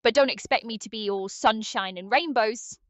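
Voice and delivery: put-on grudging British chef accent; British Chef Ramsay. AI co-host telling viewers how to prepare a roast, brusque British accent. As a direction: keep up a brusque British accent while instructing. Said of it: "I'll keep up this bloody accent".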